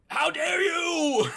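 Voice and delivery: loud, high, drawn-out mock outrage; hammed-up scandalised yell, stretching every word; outraged shout. Foster mother slapping her daughter, hammed-up outraged shout.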